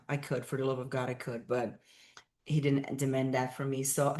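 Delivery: ordinary talk, nothing marked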